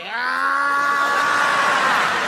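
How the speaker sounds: high shrieking yell of a lunatic; mock scream. Attacker lunging at Dave, high shrieking yell. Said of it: "He was like".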